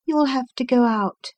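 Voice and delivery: the doctor's wife, lightly voiced; wife's voice. A narrator's reading. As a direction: speak up a bit as the wife. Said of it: "said she".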